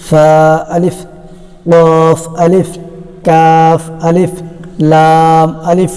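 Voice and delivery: first letter name drawn long, short "আলিফ" after; joined-letter pronunciation demo. Shown as a demonstration. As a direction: chant each letter slowly and level, then a quick আলিফ. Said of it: "সেগুলো কিভাবে উচ্চারণ করতে হবে".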